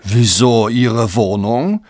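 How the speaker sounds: slow, drawn-out puzzled question; unperturbed chewing. Acted. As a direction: ask slowly, unbothered, between bites. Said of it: "während er gleichmütig weiterkaute". (gleichmütig, gedehnt nachfragen)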